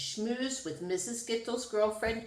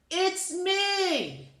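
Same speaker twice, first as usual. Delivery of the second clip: shouted; 9 semitones higher than usual. A narrator's reading. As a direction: call out cheerfully to announce yourself. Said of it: "Rudy shouted".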